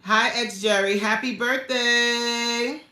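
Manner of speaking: high, loud, drawn-out well-wishing; excited birthday wish. Real, not acted. Host wishing Jerry happy birthday on the live, excited. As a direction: stretch it out in celebration.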